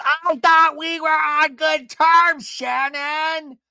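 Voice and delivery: slow, high, loud accusation; hammed-up drunken outrage; mock heated yell. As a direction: imitate a reality star who's lit, yelling. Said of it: "She is lit".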